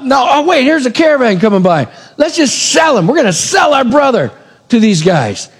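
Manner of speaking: high, rising to an emphatic shout; scheming brothers' excitement. Acted. Joseph's brothers spotting a caravan to sell him to, excited. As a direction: pitch it up, seized by a new idea.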